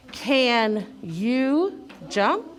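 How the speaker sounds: "Can you jump" read very slowly word by word, rising on "jump"; reading a question. Shown as a demonstration. Teacher leading children's read-along, slow questioning tune. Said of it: "my voice needs to go up at the end".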